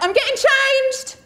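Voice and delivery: high, loud, drawn-out yell; panicked call-out. Retold or imitated. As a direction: shout it through a door to parents. Calling out to knocking parents.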